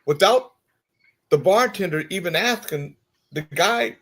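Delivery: ordinary delivery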